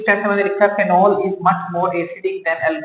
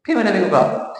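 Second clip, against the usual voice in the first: ordinary talk, nothing marked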